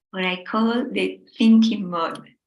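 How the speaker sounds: usual speaking voice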